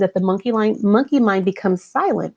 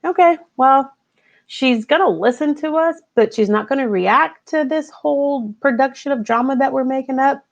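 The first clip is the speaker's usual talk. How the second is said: monkey mind voice; 6 semitones higher than usual. Acted. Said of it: "then it just starts to saying".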